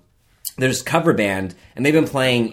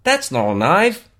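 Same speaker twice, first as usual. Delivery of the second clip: higher, slow, careful attempt at Australian; learner's bad Australian; 5 semitones higher, slower than usual. Shown as a demonstration. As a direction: try the Australian accent slowly, unconvincingly. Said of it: "give Kevin your".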